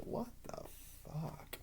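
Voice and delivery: high, very soft disbelief; hushed bafflement. Retold or imitated. Reviewer reacting to the ghost storyline, hushed bafflement. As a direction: squeak it out almost under the breath.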